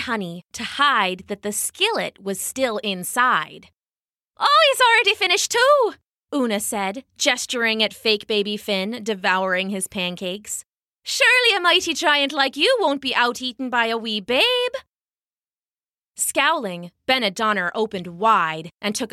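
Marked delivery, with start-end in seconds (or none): 4.4-5.9 much higher, louder and quick mock pride; proud mother showing off her baby; bright mother. 11.0-14.7 light, high motherly voice; a mother covering for her baby; sweet Irish mother, higher; Una's sweet voice. Acted. Una goading the giant.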